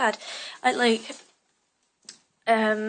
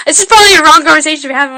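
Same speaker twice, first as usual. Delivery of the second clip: loud fast aside; 5 semitones higher, 18 dB louder, much faster than usual. Real, not acted. Loud quick aside to camera.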